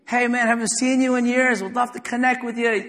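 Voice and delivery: high, loud, eager hello; friendly greeting. Retold or imitated. Preacher imitating a friend greeting him at the park, high and eager. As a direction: call out like spotting an old friend. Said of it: "He goes".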